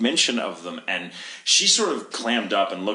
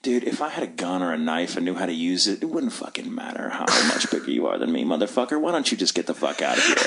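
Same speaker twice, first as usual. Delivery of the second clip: psycho threat; 6 semitones lower than usual. Retold or imitated. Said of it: "looked at him really psycho".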